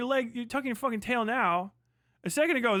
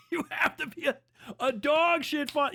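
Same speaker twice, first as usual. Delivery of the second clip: higher, laughing through the words; laughing repeat; 6 semitones higher than usual. Real, not acted. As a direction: say it again while cracking up. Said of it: "You have to be a dog shit father".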